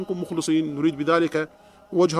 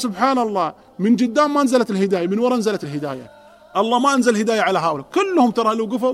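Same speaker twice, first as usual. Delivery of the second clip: marvelling exclamation; 4 semitones higher, 5 dB louder than usual. Retold or imitated. Convert marvels at guidance.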